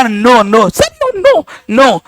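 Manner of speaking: mock pleading. Beaten pupil begs sir to stop, mock pleading. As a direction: play a thrashed pupil begging, hammed up. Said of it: "no जो करने लगा".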